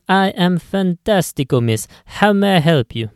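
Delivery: light Italian shopkeeper lilt; beaming Italian deli-man; Italian clerk accent. Acted.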